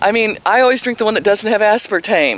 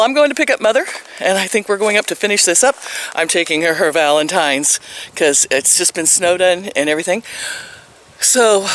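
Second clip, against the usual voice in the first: ordinary talk, nothing marked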